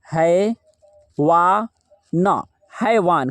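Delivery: slow syllable-by-syllable dictation; slow spelling out. Shown as a demonstration. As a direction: dictate each piece slowly for writing. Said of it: "यहाँ पे है लिखिए".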